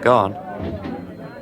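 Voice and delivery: hushed, urging whisper. Real, not acted. [name] coaxing Richard to reveal the secret, whispering. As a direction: coax him on under your breath.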